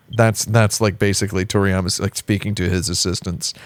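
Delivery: usual speaking voice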